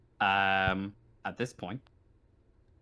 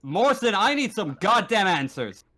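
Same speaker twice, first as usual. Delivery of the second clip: loud, higher, exasperated demand; angry demand; 6 semitones higher, 4 dB louder than usual. Acted. Marshal demanding answers from Morrison, angrily. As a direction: bark it at a subordinate.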